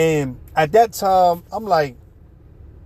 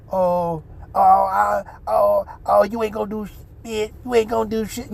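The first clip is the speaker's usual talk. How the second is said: lightly mocking effeminate voice, a bit higher; a hint of a camp taunt, half-hearted; retold taunt from the men, lightly mocking; imitating effeminate men; 5 semitones higher than usual. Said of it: "I don't really wanna imitate them".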